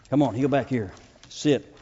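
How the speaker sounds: normal talking voice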